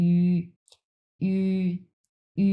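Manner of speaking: "ই" held as a rounded, pressed ü; steady teacher's demo of "ই" as ü; demonstrated ü vowel. Shown as a demonstration. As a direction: say "ই" long and level, lips tightened into ü. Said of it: "এরকম".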